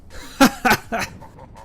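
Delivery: high hammy villain cackle; evil villain laugh. Acted. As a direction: cackle like a cartoon demon. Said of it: "the villain laughing at you".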